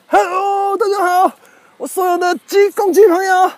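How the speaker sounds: high, ringing hello to a crowd; excited greeting. Real, not acted. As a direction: hail your fans at the top of your pitch. Hailing his rooster fans.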